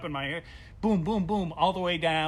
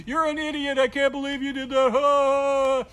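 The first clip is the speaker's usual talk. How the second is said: high, loud laughing mockery; jolly mocking voice through laughter; 9 semitones higher, 9 dB louder, slower than usual. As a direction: imitate a friend laughing at you. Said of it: "he's doing it a little too much".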